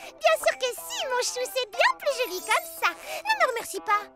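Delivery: sassy. Acted. Sassy friend reorganizing books.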